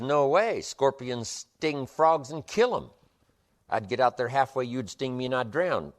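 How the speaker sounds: frog refusing. Acted. Frog voiced refusing the scorpion.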